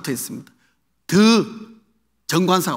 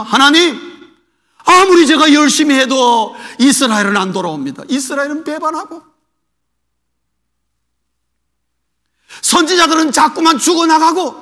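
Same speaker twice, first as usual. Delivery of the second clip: higher, louder pleading complaint; Elijah's lament; 5 semitones higher, 4 dB louder than usual. Retold or imitated. Preacher voicing Elijah complaining to God, pleading. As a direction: cry out frustration to God.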